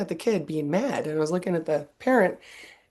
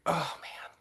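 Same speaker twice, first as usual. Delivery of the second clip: worried whisper to herself; 10 dB quieter than usual. Retold or imitated. Mother fearing her kid will brag, whispering. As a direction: breathe it out under the breath. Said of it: "And I'm like".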